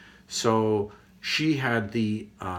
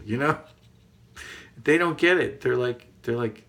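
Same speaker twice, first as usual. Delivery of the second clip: usual speaking voice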